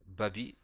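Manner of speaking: Babi in two clear syllables, "ba-bi"; model for repeating. Shown as a demonstration. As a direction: say Babi with a rising end for a learner to echo. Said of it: "essaye de répéter après moi".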